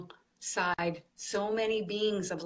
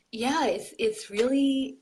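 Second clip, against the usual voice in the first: usual speaking voice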